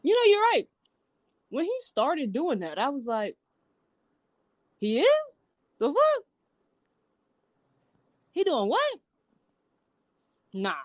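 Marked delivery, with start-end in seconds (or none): 0.0-9.0 high, disbelieving exclamations; a friend hearing the cousin's lyrics, high and incredulous. Retold or imitated. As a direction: react like you can't believe it. Stunned reaction to rapper cousin.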